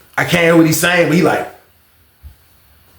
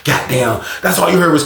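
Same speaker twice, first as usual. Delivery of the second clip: ordinary talk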